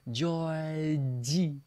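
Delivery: "Georgie" stretched into a smiled ee; big-smile pronunciation. Shown as a demonstration. Teacher repeats for students to copy, smiled "Georgie". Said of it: "you have to say with a big smile".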